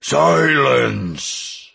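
one drawn, commanding bellow from a dragon; dragon's roar. Acted. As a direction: roar it out to shut them up. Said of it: "roared Renox".

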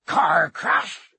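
indignant, incredulous roar; outraged giant's bellowed question. A narrator's reading. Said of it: "roared Hagrid".

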